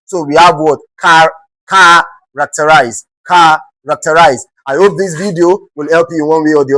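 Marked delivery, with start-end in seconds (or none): 1.0-4.5 "characterize" slowly, CHA drawn out and set apart; stress on first syllable. Shown as a demonstration. Teacher demonstrating the exception to students, slow first-syllable stress. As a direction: hold the "cha" of "characterize", then finish the word. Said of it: "expected to stress the first syllable".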